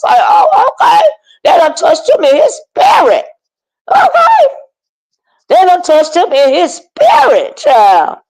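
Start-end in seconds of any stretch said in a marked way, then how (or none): 0.2-1.1 high squealed oh, okay; surprised oh okay. Retold or imitated. Reacting to Honest Row going at Bree, high surprised squeal. 3.9-4.5 high squealed okay; surprised okay. Real, not acted. Vlogger reacting to online drama, high squealed okay.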